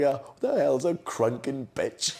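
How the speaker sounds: slow, laughing baffled Dad. Retold or imitated. Dad asking Stu about his night out, laughing. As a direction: drag it out, cracking up. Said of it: "Dad was like".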